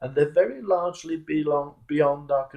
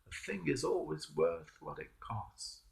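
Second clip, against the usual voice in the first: the maxim again, much softer; softer reflective repeat; 13 dB quieter than usual. Shown as a demonstration. Preacher repeats his maxim to the congregation, softly. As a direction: let it sink in quietly.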